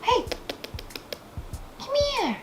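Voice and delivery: high calling voice; talking to a pet. Real, not acted. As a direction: call the pet sweetly. Calling the cat over.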